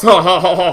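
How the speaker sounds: big, loud mock ho-ho laugh; Santa Claus laugh. Shown as a demonstration. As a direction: boom out a jolly Santa laugh in jest. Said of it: "I don't have a big, like, Santa Claus".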